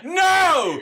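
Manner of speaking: very high, loud anguished scream; despairing yell, pitch way up; Michael Scott "No!". Retold or imitated. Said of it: "I did the Michael Scott from The Office".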